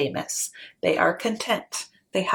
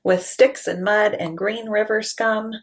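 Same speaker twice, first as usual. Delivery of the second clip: plain reading voice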